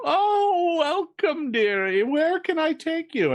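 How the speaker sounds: put-on very high, sing-song old-woman welcome; Malvina's crone voice. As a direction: greet them like a doting witch. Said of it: "you hear Malvina go".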